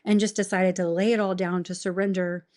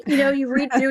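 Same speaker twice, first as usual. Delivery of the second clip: loud laughing aside; laughing while telling a friend; 6 semitones higher, 8 dB louder than usual. Real, not acted. Laughing at own journals.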